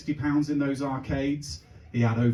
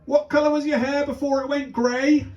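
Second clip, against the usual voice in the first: put-on high-pitched child calling Daddy; imitating a child, high and insistent; young son; 11 semitones higher, 4 dB louder than usual. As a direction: pipe up like a kid calling from another room. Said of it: "This was followed up with".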